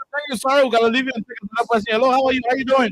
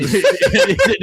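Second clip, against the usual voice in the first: bursting into laughter mid-sentence; real laugh breaking out, higher and louder; 14 semitones higher, 3 dB louder than usual. Real, not acted. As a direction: laugh right through the words. Host laughs at caller's remark.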